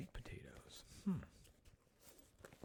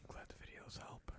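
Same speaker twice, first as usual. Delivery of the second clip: soft unvoiced whisper, much quieter; 6 dB quieter than usual. Shown as a demonstration. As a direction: breathe the words out in a whisper.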